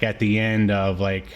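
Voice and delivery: normal talking voice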